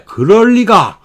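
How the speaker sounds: 놀란 듯이; mock surprise. Shown as a demonstration. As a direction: sound surprised and doubting. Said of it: "놀람을 나타내는 거예요".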